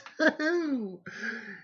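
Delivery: high-pitched drawn-out giggle; high put-on laugh. Imitator finishing the child impression, high put-on laugh.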